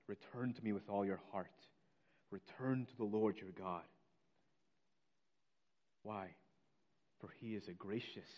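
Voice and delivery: low, quiet scripture reading; soft, solemn. Real, not acted. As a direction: read the verse gently, well under preaching volume. Gentle re-reading of the verse.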